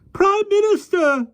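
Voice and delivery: very high, slow anxious call; fluttery call. A narrator's reading. Miss Lark calls from the tree, very high call. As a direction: call out in a fluster. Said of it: "called Miss Lark again".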